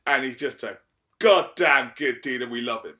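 usual speaking voice

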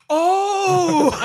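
high, loud startled "Oh!" with a laugh; surprised gasp. Acted. Gnome suddenly realizes something about the travelers, high startled cry. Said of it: "expression changes ... excitement to".

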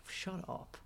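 breathy, hushed disbelief; a hint of stunned whisper; whispered shut up. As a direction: say it under the breath in shock. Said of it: "And Eddie's like".

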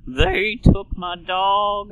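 slow, high, creaky country drawl; vocal fry country singer. Retold or imitated. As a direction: croon it with heavy vocal fry. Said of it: "male country singer that uses vocal fry".

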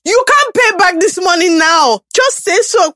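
high-pitched impatient challenge; mock exasperation. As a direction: imitate an uncle calling your bluff. Acting out uncle confronting her.

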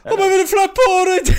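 put-on high, laughing Birmingham accent; Brummie accent. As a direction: giggle through a Brummie line. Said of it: "Brummie accent".

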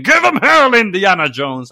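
loud, high rallying cry; Sala. Retold or imitated. Host quoting the trailer scene, rousing shout. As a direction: cheer the hero on at full voice.

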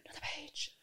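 mock whisper. Mocks pupils asking for pages.

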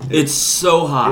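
slow, loud, strained with laughter; groaning through the burn; mouth on fire. Real, not acted. Ghost pepper burning his mouth.

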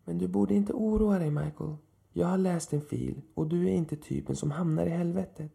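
put-on calm him down with a kindly old man's smile; reassuring old man. A narrator's reading. Old man reassures Michael about hell, gently. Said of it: "försökte lugna mig".

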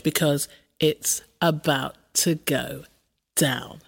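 sultry, slow radio host read; podcast intro. Acted. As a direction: tease the listener like a late-night host.

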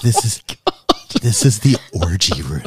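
breathy, whispered seduction; sexy whisper. Shown as a demonstration. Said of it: "that's actually not how you said it".

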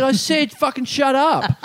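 high, slow, forceful command; drawn-out mock-furious order; angry retort.

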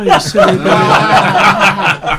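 loud, drawn-out posh villain; very slow, loud villain sneer; Hugh Grant villain voice. Shown as a demonstration. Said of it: "the Hugh Grant villain voice".

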